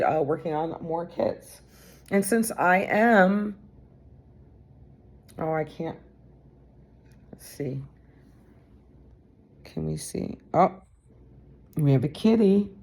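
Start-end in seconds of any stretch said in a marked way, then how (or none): none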